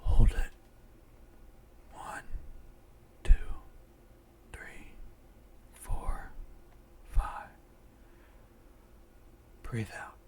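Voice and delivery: slow, mostly whispered breathing count; guided breathing meditation. Acted. Guide a friend through breathing in and out, hushed meditation voice.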